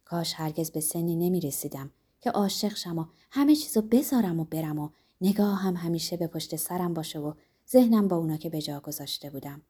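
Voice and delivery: ordinary delivery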